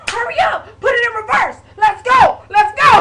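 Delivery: very high, very loud frantic shouting; panicked screaming. Retold or imitated. Brother yelling at his sister to reverse and go, high frantic shout. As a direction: bark the orders like the cops are coming. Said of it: "my brother was screaming".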